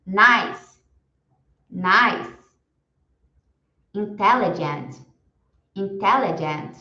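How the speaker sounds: each word said slowly and clearly, then repeated; vocabulary drill for students to repeat; repeat-after-me drill. Shown as a demonstration. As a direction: model every word for the class to echo. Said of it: "Eu vou falar e vocês repetem em casa".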